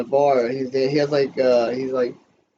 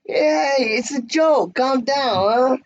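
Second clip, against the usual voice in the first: high mob yell; 13 semitones higher, 4 dB louder, slower than usual. Retold or imitated. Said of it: "He goes".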